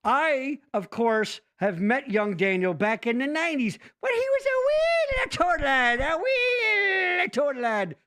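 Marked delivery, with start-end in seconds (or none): 1.6-8.0 silly storyteller voice. Pretend. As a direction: coo it like a Scottish granny. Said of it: "I don't know why I did that voice".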